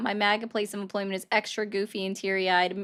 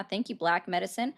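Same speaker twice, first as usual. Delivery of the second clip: ordinary delivery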